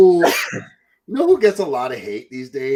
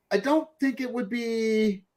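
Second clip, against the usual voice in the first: usual speaking voice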